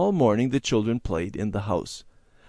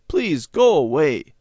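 slow, louder begging; pleading call; 6 semitones higher, 6 dB louder, slower than usual. A narrator's reading. Boy begging the rain to leave, slow plea.

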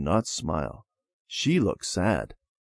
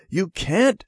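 put-on alarmed little girl; 10 semitones higher than usual. A narrator's reading. Debbie objects to brother.

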